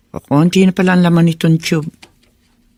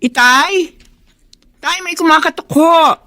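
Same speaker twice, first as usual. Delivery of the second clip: calling out; 11 semitones higher than usual. Acted. Calling father from afar.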